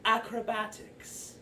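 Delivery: drawn-out, showy interjection; Glister interrupting. Acted. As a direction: stretch the word out theatrically. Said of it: "Glister interrupts and goes".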